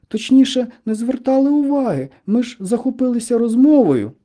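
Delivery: put-on high-pitched, loud girlish voice; high, protesting schoolgirl voice; girls answering together. A narrator's reading. The two girls explain themselves.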